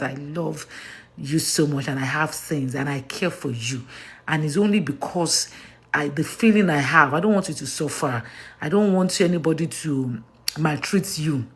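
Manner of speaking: low, soft heartfelt reassurance; softly spoken, earnest affection; sincere, tender. Real, not acted. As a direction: tell them gently that you truly care. Sincere reassurance to her followers.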